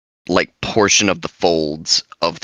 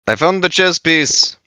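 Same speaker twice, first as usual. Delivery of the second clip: high excited character voice; 9 semitones higher, 3 dB louder than usual. Acted. Bori reports his finds excitedly.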